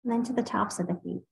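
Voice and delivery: unhurried meditation guide with long pauses; guided meditation. Real, not acted. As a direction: ease listeners through a body scan, leaving space between phrases. Leads a body scan meditation.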